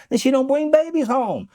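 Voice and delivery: ordinary talk, nothing marked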